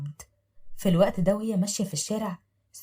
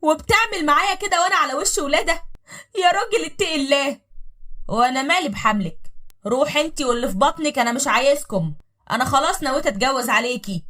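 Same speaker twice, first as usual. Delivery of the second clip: loud, raised scolding husband; harsh husband; 7 semitones higher, 5 dB louder than usual. Acted. Husband shouting at his pregnant wife, loud and harsh.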